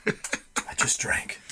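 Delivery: slow, whispered admission; soft, drawn-out whisper of shame; hushed confession. Retold or imitated. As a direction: confess it under your breath, very slowly. Retold drunken confession by phone.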